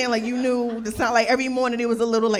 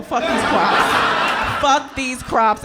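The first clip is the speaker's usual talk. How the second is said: mock outburst, laughing; 2 semitones higher, 7 dB louder, slower than usual. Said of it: "I'd be like".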